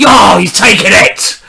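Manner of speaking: high, loud thrilled shout; excited shout. Real, not acted. Streamer thrilled as the opponent takes the pawn, excited shout. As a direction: shout it out in delight.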